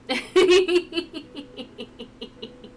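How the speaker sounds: long, high, loosening giggle; laugh. Real, not acted. Reacting after seeing this part before, helpless laughing. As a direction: giggle helplessly and let it tail off.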